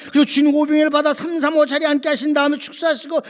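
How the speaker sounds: loud, driving sermon retelling, pitch held up; full-force preaching. Real, not acted. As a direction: belt out the Bible story without letting up.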